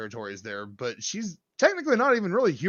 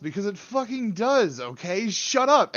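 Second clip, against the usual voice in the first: higher, rising to a loud shout; orc snapping; 6 semitones higher, 4 dB louder than usual. Pretend. Orc answers a question about his ride, irritated shout. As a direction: snap back, annoyed. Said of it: "Why does your ride go that fast?".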